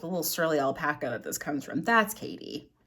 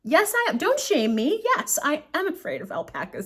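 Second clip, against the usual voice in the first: high, emphatic comic self-defense; playfully indignant, pitched way up; mock defiant; 9 semitones higher, 4 dB louder than usual. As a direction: own up with exaggerated defiance. Acted defiant answer to friends.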